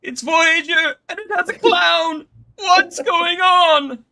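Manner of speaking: high, slow booming announcer character; Agamis voice. Acted. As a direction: ham it up as a grand herald. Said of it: "I am Agamis".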